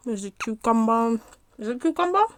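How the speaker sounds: talking while chewing; words round a bite of food; mouth full. Real, not acted. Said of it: "it's hard talking and eating at the same time".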